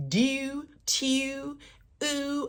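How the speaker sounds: high Baltimore vowels drawn out on tew and ooh; Baltimore accent. Retold or imitated. Kimmy shocked at dinner in a high Baltimore accent. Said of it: "her accent came all the way out".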